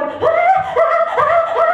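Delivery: high mock wails of a panicked kid; mock screaming kid. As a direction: imitate the kid shrieking on the ride. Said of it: "he starts screaming bloody murder".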